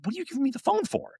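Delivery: startled protest. Retold or imitated.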